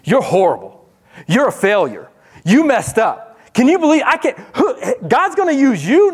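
preacher voicing a sneering accuser; mocking accuser. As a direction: taunt them like a jeering accuser. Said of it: "He's an accuser".

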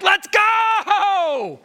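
high, long-held yell; inward impatient shout. Acted. Pastor inwardly urging his church on, impatient shout. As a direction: burst out hollering, stretching GO. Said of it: "Inwardly, I'm like".

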